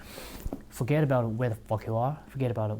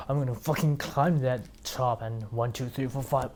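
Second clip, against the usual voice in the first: quiet, strained count of steps; straining effort; 6 dB quieter than usual. Acted. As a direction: push out each step like the air is thin. Acting out a hard summit push.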